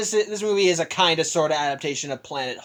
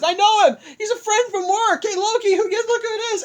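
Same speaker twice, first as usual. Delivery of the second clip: much higher, excited and laughing; delighted; 4 dB louder than usual. Retold or imitated. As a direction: cheer up like you've just spotted an old friend. Thor delighted to see Hulk.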